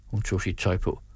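ordinary delivery, nothing marked

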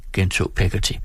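plain reading voice

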